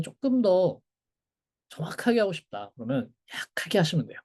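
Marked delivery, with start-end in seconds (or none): none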